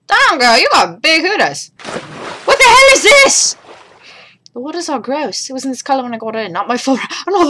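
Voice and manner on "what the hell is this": high, loud outraged question; shocked yell. Acted. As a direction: yell it in disbelief. Shocked at game scene.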